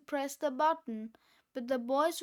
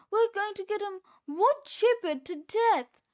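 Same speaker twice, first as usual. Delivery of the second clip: high, loud panic; panicked wail; 6 semitones higher, 6 dB louder than usual. A narrator's reading. Fluffy heading for a wood chipper, panicked. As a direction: cry out in doom. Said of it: "cried Fluffy".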